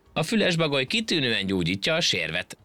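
high, cheeky ant voice. Acted. Ant taunts the monkey, high and cheeky. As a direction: tease the straining monkey. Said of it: "szemtelenkedett a hangya".

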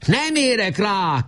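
high slow call over the shoulder; shouted back. A narrator's reading. Nemecsek shouting back to Weiss while running, high. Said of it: "kiáltotta vissza Nemecsek".

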